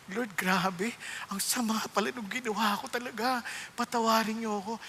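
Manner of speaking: hushed, humbled confession; repentant prayer. Retold or imitated. Praying to the Lord while reading the word, softly contrite. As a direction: murmur an apology to God.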